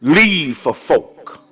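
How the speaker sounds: high-pitched pulpit exhortation in spaced phrases; emphatic preaching. Real, not acted. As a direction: urge the congregation, letting each phrase fall. Exhorts the congregation.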